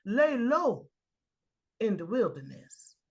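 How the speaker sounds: a voice in the storm calling loud and clear. A narrator's reading. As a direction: ring it out like a voice calling through the storm. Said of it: "a voice I hear saying loud and clear".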